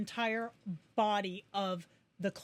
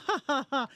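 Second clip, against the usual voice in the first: louder, higher mock "ha ha ha"; imitated laugh; 5 semitones higher, 5 dB louder, faster than usual. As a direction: act out a loud laugh in separate beats. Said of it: "not only did he go".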